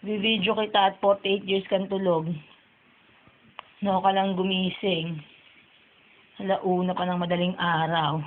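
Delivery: usual speaking voice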